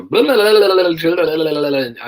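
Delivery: high loud gabbled blah blah; rapid Polish torrent. Retold or imitated. Arrested detainee pouring out Polish at the sergeant, high loud gabble. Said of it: "they then go".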